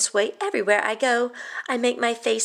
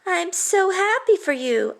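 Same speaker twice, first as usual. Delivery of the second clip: forced happiness; 8 semitones higher than usual. Acted. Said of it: "only I don't really mean it".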